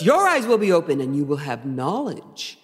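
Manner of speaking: solemn tempter with heavy emphasis; Michael Baum Lucifer. Acted. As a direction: lean grandly on key words like a stage devil. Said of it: "That's how he says it".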